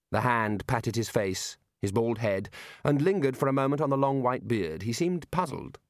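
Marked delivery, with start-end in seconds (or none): none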